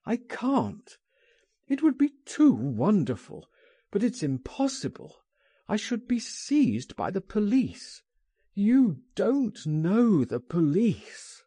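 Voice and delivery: high-pitched, agitated protest; distressed cry. A narrator's reading. Oscar fears being seized by the police, crying out. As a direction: plead fearfully, dragging out the final words. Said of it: "he cried".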